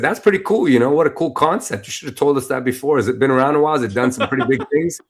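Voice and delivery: impressed team. Retold or imitated. The impressed event team quizzing him about the company, eager. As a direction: gush with curiosity about the company.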